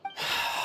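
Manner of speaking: soft unvoiced tired sigh; weary sigh. Knight feeling down sighs wearily to the travelers. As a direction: let out a tired breath.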